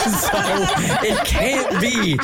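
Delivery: slow words through laughter; laughing, slow. Real, not acted. Cohost still laughing that it can't be simple. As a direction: drag it out while still laughing.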